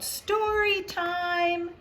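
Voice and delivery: high, slow, drawn-out sing-song call; jolly call. Acted. Teacher calling noisy children to the book corner, slow and jolly. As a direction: call the whole class over, stretching it. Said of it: "a loud, jolly voice called out".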